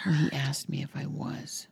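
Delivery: soft whispered confession. Real, not acted. Mother confiding about her son's question, whispered.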